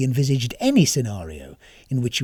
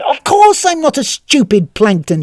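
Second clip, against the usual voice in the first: loud, higher angry shout; 10 semitones higher, 6 dB louder than usual. A narrator's reading. Tanisan fed up with the phone menu, shouting. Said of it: "shouted Tanisan".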